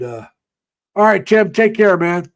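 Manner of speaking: usual speaking voice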